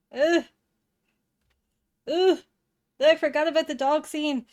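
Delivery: high, loud bursts of revulsion; real disgust. Real, not acted. Watcher who forgot about the dog scene, grossed out. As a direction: recoil in disgust, pitch jumping up.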